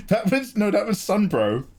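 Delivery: laughing. Real, not acted. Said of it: "that wasn't Bane".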